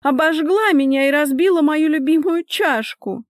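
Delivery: high, loud complaining woman; aggrieved victim. A narrator's reading. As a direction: accuse loudly, pitched high. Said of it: "занять более выгодную позицию жертвы". (громкая обиженная жалоба)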